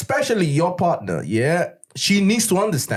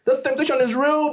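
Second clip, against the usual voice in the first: emphatic exclamation; 9 semitones higher than usual. Real, not acted. Exclaiming over the other speaker.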